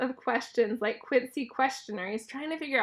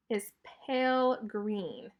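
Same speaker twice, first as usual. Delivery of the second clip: plain reading voice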